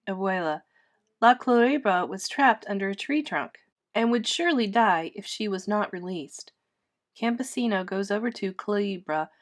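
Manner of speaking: ordinary talk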